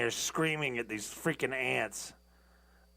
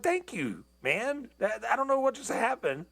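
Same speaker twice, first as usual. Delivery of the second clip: grateful gush; 10 semitones higher than usual. Retold or imitated. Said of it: "I'm like".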